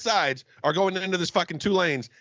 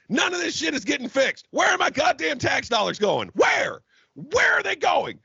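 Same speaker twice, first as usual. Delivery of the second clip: furious rant; 7 semitones higher than usual. Real, not acted. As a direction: demand an answer, outraged. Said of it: "I knew this would send him on a tirade".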